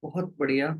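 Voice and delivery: low, unhurried meditation-guide voice with long pauses; guided meditation. Real, not acted. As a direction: settle the group gently, leaving long silences. Leads group into meditation.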